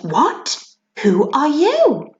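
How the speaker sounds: rising, slow startled question; surprised princess. Acted. Princess startled by the elf, a rising slow question. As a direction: sound startled by the stranger.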